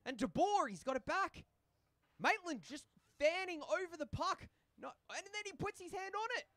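high, loud excited hockey commentary; live play-by-play. Real, not acted. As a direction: call the play at rising pitch, building excitement.

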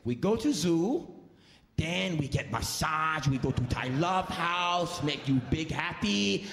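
slow, bargaining voice; driver's broken English. Retold or imitated. Driver pitches the massage and Thai Love House, slow. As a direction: sell the deal slowly, hopeful.

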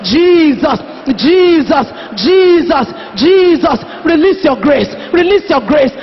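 repeated, drawn-out calls on Jesus; fervent, forceful pleading prayer; crying out in prayer. Real, not acted. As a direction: call on the name insistently. Cries out in prayer.

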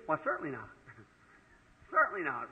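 soft chuckling denial, voice dropping low; laughing it off. Real, not acted. Preacher laughing at being asked if he fears the FBI, softly chuckling. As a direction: laugh away the idea of being afraid.

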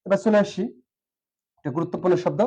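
ordinary talk

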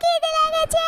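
high, small voices begging for food; tiny babies nagging for something to eat; demanding baby mice. Acted.

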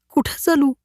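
high, sharp woman's question; a young village woman cutting the man off sharply; sharp retort. Acted. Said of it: "मंजुळेच्या आवाजात तीक्ष्णता निर्माण झाली".

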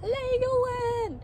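high, drawn-out cheer; excited. Real, not acted. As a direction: stretch it out with delight. Excited echo of child's answer.

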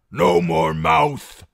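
very loud, slow, brutal order; rough bellow. A narrator's reading. Jailer grabs the singer by the collar, bellowing. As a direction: bellow at the prisoner to shut up.

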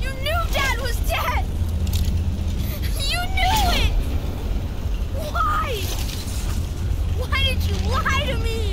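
loud, high, anguished shouting; furious accusation. Acted. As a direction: hurl the betrayal at your brother. Boy accuses brother of lying.

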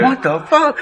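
high, stunned "what the fuck"; watching the plunger stirring in shock; shocked outburst. Retold or imitated. To the listeners, reliving it high and shocked. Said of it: "I'm like".

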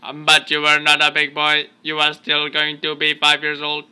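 monotone robot, every word on one pitch. Pretend. Parent teasing child about age, monotone robot voice. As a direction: keep it mechanical, no inflection. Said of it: "talking like a robot".

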